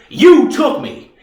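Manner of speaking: slow, high, loud accusation; accusing outburst. Acted. Reeves accuses the Army of taking him, loud and angry. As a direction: throw the blame hard and slow.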